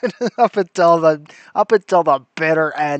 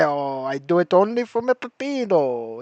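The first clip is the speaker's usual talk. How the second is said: put-on higher, playful Italian accent spoofing the sweeper; mock Italian accent; 7 semitones higher, 4 dB quieter than usual. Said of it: "I love that accent".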